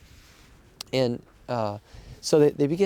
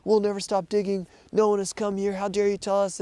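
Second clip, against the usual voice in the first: higher-pitched retold outrage; angry miners; 6 semitones higher than usual. Turkish miners confronting the rescuer on the rubble, higher retold anger. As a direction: throw the miners' defiance back, pitch raised. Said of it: "and they're like".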